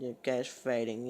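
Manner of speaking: slurred, slow blubbering imitation; mock crying. Imitating her crying over the guys fighting, mock crying. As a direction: imitate barely intelligible sobbed words. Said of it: "I could barely understand her 'cause she was crying so much".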